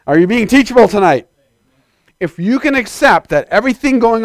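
loud, high-pitched pulpit push; urgent preaching call; exhorting. Real, not acted. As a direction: drive it home to the church.

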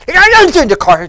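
loud, garbled babble; mock mumbling. Preacher imitating the driver mumbling all the way, garbled. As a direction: jabber indistinctly, imitating a grumbler. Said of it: "just mumbled all the way to".

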